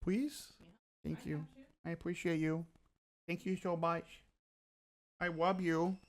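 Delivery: soft, sweet, grateful murmur; tender thanks. Real, not acted. Streamer softly thanking Mari for the sandwich and water. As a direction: thank her softly and lovingly.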